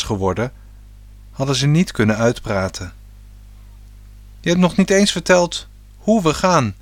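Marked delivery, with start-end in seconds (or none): 4.4-6.7 put-on boy calls out, higher and louder; calling out. A narrator's reading. Boy calls out to his father about the trip, raised voice. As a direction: call after his father eagerly. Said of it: "riep hij".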